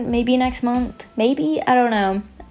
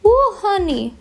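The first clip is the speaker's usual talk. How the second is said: much higher, playful "ooh, honey"; high exclamation; 10 semitones higher, slower than usual. Pretend. Reacting to their own messy hair, high exclamation. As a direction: pitch it way up in mock dismay.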